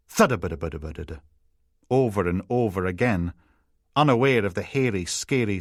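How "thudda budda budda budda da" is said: low, rapid thudding rattle; imitated toy noise. A narrator's reading. Narrator imitates something the children play with, rapid low thudding noise. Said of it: "playing with something that went".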